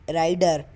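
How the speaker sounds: राइडर said with a clear D sound, not Z; correct pronunciation. Shown as a demonstration. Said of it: "राइजर नहीं".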